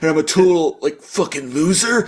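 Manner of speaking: hammed-up defensive rant; high, indignant string of questions; Grifter. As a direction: act out wounded, accusing self-pity. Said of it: "really projecting and putting words in his mouth".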